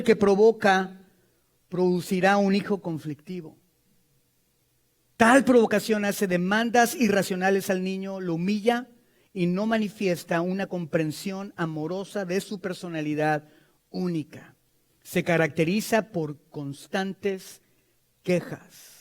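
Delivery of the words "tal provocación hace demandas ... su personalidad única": ordinary talk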